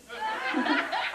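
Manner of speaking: a high-pitched burst of laughter; high giggling laugh. Real, not acted. Laughing at own story.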